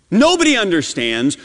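usual speaking voice

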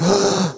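sharp high gasp; sudden intake of breath in shock; re-enacted startled gasp. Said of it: "I literally went".

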